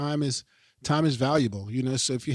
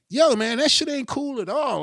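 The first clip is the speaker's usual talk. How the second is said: imitating someone calling it out; 10 semitones higher, 4 dB louder than usual. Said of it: "Or like".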